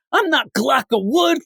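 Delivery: dummy voice. Retold or imitated. Ventriloquist dummy voice.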